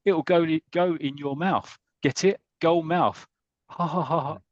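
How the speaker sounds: a schoolboy pleased with his own bad pun; cheeky little kid. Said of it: "one little kid there goes".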